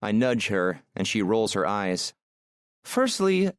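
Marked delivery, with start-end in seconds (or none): 2.8-3.5 high woman's voice making a point; Aurora, indignant. Acted. Woman answering her driver's teasing, indignant. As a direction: open the rebuttal high and firm.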